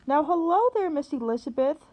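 loud, high, booming church-lady greeting; bellowing. Acted. Big woman in a hat hails Liz across the aisle, bellowing. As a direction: boom it across the theater aisle. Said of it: "bellowed the voice".

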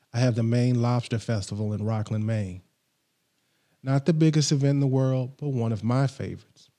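ordinary talk, nothing marked

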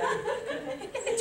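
high laughing okay. Real, not acted. Teacher laughing at the class's scrunched faces.

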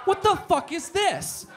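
high, loud indignant shout; furious jealous yell, played for laughs; outraged yell. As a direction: bellow it in mock outrage. Angry discovery of rival.

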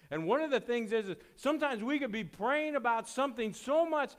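high, loud preaching push; preaching at full force. Acted. Pastor warning the church about making idols, loud and high. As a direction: drive the point home to the congregation.

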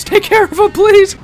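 very high, loud squeal for help; frantic plea. Real, not acted. Player under fire to his gang, frantic plea. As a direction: beg your gang in a squealing panic.

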